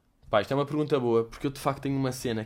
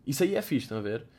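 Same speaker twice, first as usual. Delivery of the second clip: usual speaking voice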